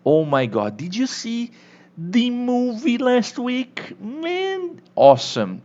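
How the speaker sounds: teacher hamming up enthusiasm about a great movie; excited gushing. Shown as a demonstration. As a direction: gush about the film like a thrilled friend. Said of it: "quando você quiser falar aquela ênfase".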